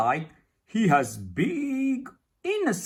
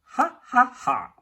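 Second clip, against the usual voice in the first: acted laugh; 5 semitones higher, faster than usual. Bursting out laughing in prayer.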